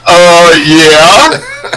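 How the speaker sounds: retold drawn-out "uh, yeah" with a big upswing; obvious yes. Both visitors answering the shop lady, incredulously eager. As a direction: answer as if it's blindingly obvious.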